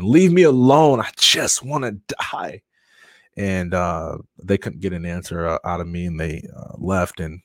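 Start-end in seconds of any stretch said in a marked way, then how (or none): 0.0-2.6 retold scream. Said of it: "I'm just crying and screaming".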